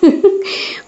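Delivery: short, airy voiceless laugh; a little acted chuckle before replying. Wife laughs at her husband's confession, short airy chuckle. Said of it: "হেসে মৈত্রেয়ী বলে".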